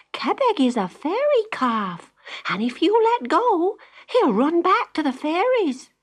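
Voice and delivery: high, quick, anxious mother voice; a worried mother cautioning her girl; mother's warning. Acted. Mother warning Jenny about the ribbon, high and urgent.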